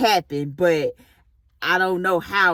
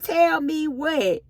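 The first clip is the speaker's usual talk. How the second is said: higher, drawn-out demand to know; retold wary "what?"; apprehensive question; 10 semitones higher, slower than usual. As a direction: ask it slowly, pitched up with alarm. Retelling her alarmed question.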